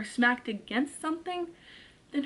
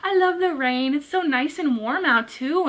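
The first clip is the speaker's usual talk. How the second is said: mock carefree sing-song; 6 semitones higher than usual. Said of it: "I'm like".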